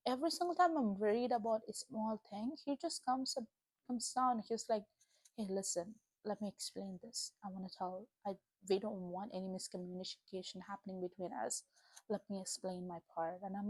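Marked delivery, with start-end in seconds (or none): 5.4-13.4 gentle voice of God. Retold or imitated.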